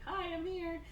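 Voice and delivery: squeaky high little announcement; mock-timid, very high hello; awkward greeting.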